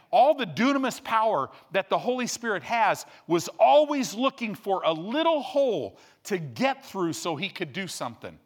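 high, loud, driving preacher voice; rising to a fervent shout; preaching at full force. Acted. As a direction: preach it hard to the congregation.

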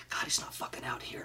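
hissed curse under the breath; frustrated whisper. Retold or imitated. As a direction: swear quietly so the audience can't hear. Frustrated on stage, inner thought.

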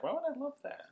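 high, puzzled squeak; mock disbelief. Telling friend about the Facebook post, high puzzled squeak. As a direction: ask it like it makes no sense. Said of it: "and I was like".

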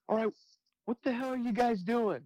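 high-pitched exasperated captain; imitated boss demanding an explanation; dad voice. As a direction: scold the crew like a fed-up dad. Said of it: "when he'd put on his dad voice".

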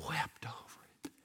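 very soft, sorrowful whisper; barely audible reverent hush. A narrator's reading. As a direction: let it drop to a solemn whisper. Jesus weeping over the city.

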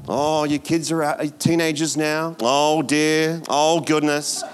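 a touch of fretful pity; mock worry. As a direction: play the gloomy well-wisher. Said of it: "People say to us, like".

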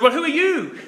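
high, loud disbelieving question with a laugh; incredulous Moses. Retold or imitated. Moses asking God his name, high and incredulous. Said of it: "Which is a wonderful moment".

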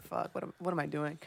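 whispered inner thought. Retold or imitated. Retold embarrassed inner thought.

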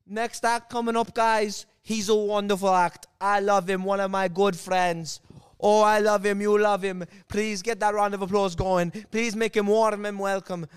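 loud imitation of an MC's intro; earnest stage introduction. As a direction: hype the next act like an earnest host. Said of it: "earnest intro".

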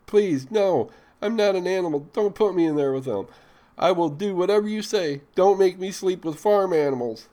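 a high, panicked plea from a captive woman; pleading. A narrator's reading. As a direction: beg him frantically not to lock her in. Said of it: "her tone changed from rage to pleading".

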